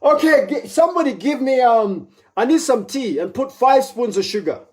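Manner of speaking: high, pleading germ voice; begging germs. Pretend. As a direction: demand sugary tea like a spoiled baby.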